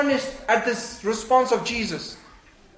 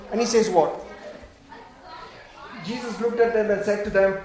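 normal talking voice

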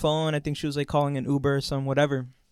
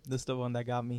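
usual speaking voice

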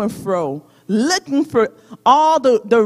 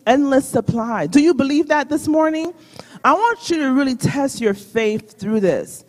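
normal talking voice

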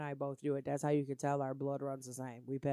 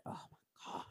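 breathless whispered aside; hushed unvoiced "oh my God"; 13 dB quieter, slower than usual. Real, not acted. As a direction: whisper it under your breath. Aside whispered while laughing.

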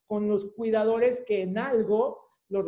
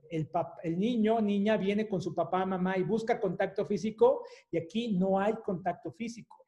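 ordinary delivery

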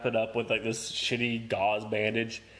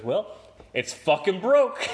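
slow, louder, higher declaration; mom's blunt verdict; 7 semitones higher, 6 dB louder, slower than usual. Retold or imitated. Mom walking in, declaring the arm broken. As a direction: slam the verdict down. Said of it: "she goes".

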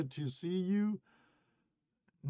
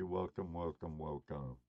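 fading echo; 9 semitones lower, 12 dB quieter than usual. Pretend. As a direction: welcome fading to a low, soft echo. Greeting viewers, fading repeats.

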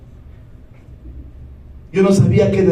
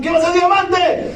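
excited shout; 7 semitones higher than usual. Retold or imitated. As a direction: shout it out like a fired-up recruit. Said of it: "Yo gritaba". (grito emocionado, más agudo y fuerte)

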